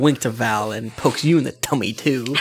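ordinary talk, nothing marked

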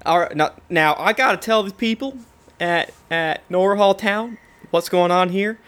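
put-on folksy Southern constable accent. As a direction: play a Southern lawman's accent. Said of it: "has a southern accent saying that".

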